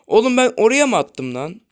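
loud, exasperated complaint; angry outburst. Real, not acted. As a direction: snap at the game. Angry at wrong pass.

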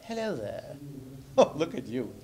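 a lightly posh cooing puppet; new puppet voice. Shown as a demonstration. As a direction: coo at it like a fond old dowager. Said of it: "let you try that out".

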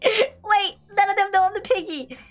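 high, giggly excitement; thrilled at the trick; excited. Real, not acted. Delighted nobody knows.

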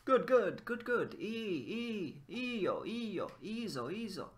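high, eager shouts of praise; excited exclamations. Shown as a demonstration. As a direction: cheer each ii zo like a fan. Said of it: "They're all just like exclamation marks".

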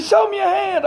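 high barked order "Show me your hand"; mock cop command. Officer's command retold.

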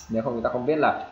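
ordinary delivery

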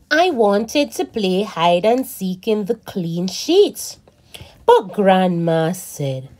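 put-on slow, expressive read-aloud for kids; reading to children. A narrator's reading. Teacher reading a story to children, animated and slow. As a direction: read it like a picture book for little ones. Reads picture book aloud.

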